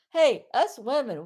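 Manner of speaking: ordinary talk, nothing marked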